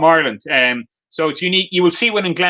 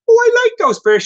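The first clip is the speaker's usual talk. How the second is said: high, louder gushing lady voice; delighted lady customer; 6 dB louder than usual. Retold or imitated. Lady at the trade show spotting his bears, high and gushing. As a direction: gush like a thrilled shopper spotting the bears.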